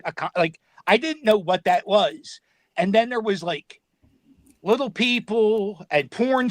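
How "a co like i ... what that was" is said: usual speaking voice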